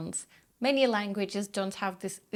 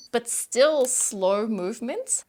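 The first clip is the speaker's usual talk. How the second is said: ordinary talk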